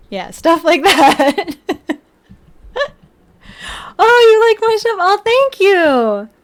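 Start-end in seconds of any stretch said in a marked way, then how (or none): 4.0-6.3 high, loud sugary thanks, slowing; high mock-gushing. Thanking a fan in a high mock-sweet voice. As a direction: gush in a high put-on voice.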